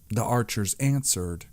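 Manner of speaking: usual speaking voice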